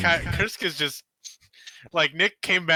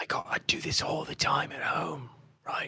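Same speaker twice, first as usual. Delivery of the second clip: whispering; 8 dB quieter than usual. Acted. Character whispers at night.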